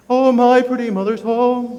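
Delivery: high, loud, slow operatic singing. Shown as a demonstration. Director modelling an opera voice for the choir. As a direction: tall space, full breath, operatic. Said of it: "in an opera voice".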